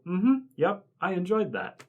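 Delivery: high, put-on contented voice; mock satisfied customer. Imitating everybody after fast food, high contented voice. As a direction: play a blandly pleased diner. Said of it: "everybody can go".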